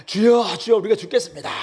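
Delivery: loud, high panicked plea to a sleeping master; desperate cry for help. Acted. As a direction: shout it like the boat is sinking. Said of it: "외치는 것입니다". (크고 높게 절박하게 외치는 목소리)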